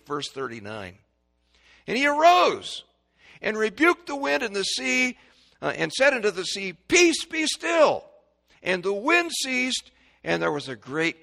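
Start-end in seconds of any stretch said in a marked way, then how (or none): 1.9-11.2 very high, loud proclamation; commanding full-voice Bible reading; thundering scripture reading. Acted. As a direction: shout the verse from the pulpit.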